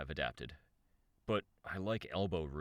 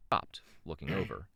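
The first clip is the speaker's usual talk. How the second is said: ordinary delivery, nothing marked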